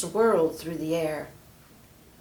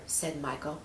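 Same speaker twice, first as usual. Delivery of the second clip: ordinary delivery, nothing marked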